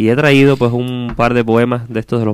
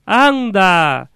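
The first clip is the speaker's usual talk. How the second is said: high, drawn-out distant call; echo; 10 semitones higher than usual. Acted. Voice answering from the other side, echoing call. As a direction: stretch it out like an echo, falling at the end. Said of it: "el eco es todo".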